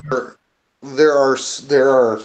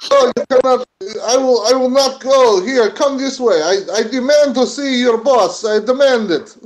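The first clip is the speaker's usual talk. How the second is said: put-on higher, insistent Russian accent; Russian-accented demand; 7 semitones higher than usual. Worker demands to see the boss, Russian accent.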